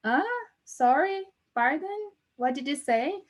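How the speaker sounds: high, rising requests to repeat; imitated puzzled listener. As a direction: mimic someone who didn't catch it. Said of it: "a pessoa fica".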